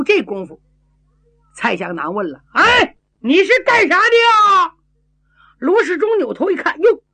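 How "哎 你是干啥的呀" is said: loud, high hail to a stranger; high-pitched shouted challenge; calling out. A narrator's reading. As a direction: holler the question, drawing out the end. Character calls out to stranger.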